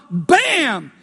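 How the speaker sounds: normal talking voice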